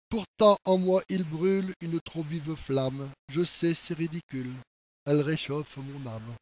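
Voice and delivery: poem recitation. Acted. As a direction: confide the burning love quietly, line by line. Reads the poem aloud. (poème récité lentement, tout bas)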